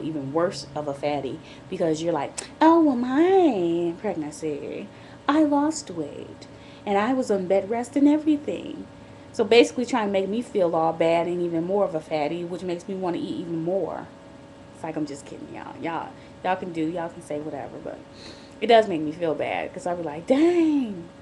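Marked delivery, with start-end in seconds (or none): 2.6-8.9 drawn-out, sing-song mock boast; exaggerated boastful mimicry; mocking imitation. 20.3-21.1 high, loud "dang"; pitched-up, drawn-out reaction; retold dismay. Retold reaction to hurtful comments.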